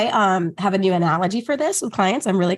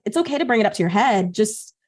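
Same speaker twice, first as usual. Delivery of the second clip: ordinary talk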